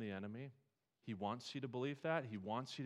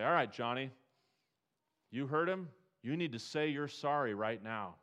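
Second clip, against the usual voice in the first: a firm, louder parent telling Johnny off; a parent ordering a kid to apologize; scolding parent; 3 semitones higher, 6 dB louder than usual. Retold or imitated. Parent to Johnny, demanding an apology: firm and loud.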